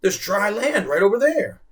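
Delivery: high, loud pointing it out; excited. Acted. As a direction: point out land eagerly. Boy points at shore.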